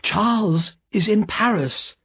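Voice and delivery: put-on high, slow, anxious young wife's cry; distressed cry. A narrator's reading. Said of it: "cried Lucy".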